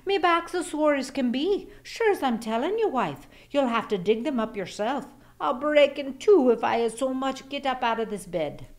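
high complaining whine, mostly fast; lazy Irish husband groaning about his back; whining excuses. A narrator's reading. Husband whining excuses to his wife about his back. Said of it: "Jamie would whine".

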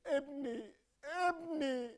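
hammed-up sob over ابني; mock-tragic wail for his son; comic grief cry. Wailing father mourning his son.

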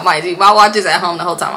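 ordinary talk

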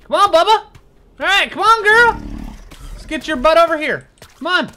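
high, loud coaxing call; calling a pet. Real, not acted. Player urging his new bear to follow, high loud call.

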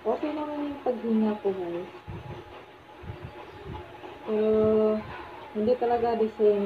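muffled behind rubber mask; speaking inside filter respirator; through a respirator mask. Real, not acted. Seen 1: Half-face respirator with pink filters covers her mouth throughout.

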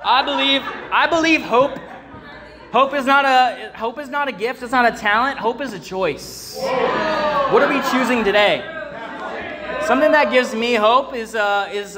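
sermon delivered with full force; fiery preaching. Real, not acted. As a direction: preach it with rising fire.

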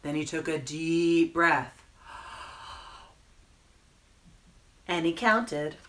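animated reading with rising, stretched suspense; children's story narration. A narrator's reading. Storyteller reading the penguin's bath time, animated narration. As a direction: lift and stretch the phrase endings for children. Reads picture book to children.

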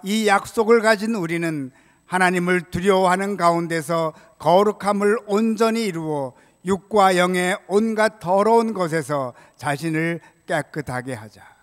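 usual speaking voice

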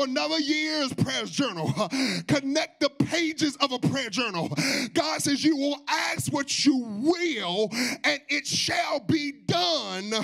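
high, loud, slow preaching cadence; full-force preaching. Real, not acted. Preacher proclaims answered prayer to the congregation, slow and loud. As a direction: proclaim it, stretching every phrase.

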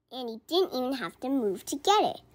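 usual speaking voice

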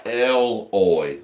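"Eloy" sounded out as EL-oy; short-E pronunciation. Shown as a demonstration. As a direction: stretch "Eloy" into two clear parts, EL and OY. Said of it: "like Elroy from the Jetsons".

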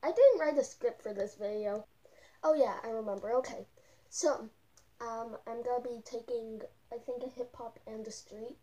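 muffled through full mask; talking inside costume head. Real, not acted. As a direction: voice enclosed by headpiece. Seen 0: Full furry costume head covers speaker's face throughout all frames.

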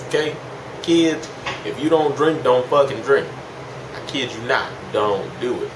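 usual speaking voice

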